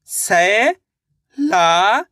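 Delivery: drawn out syllable by syllable, rising at the end; stretched syllables. Shown as a demonstration. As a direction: sound out सैलाब slowly, piece by piece. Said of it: "तो मिलके बन जाएगा सैलाब".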